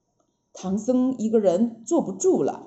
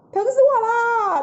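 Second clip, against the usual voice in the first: cry of pain; 11 semitones higher than usual. A narrator's reading. Monkey King in headache pain.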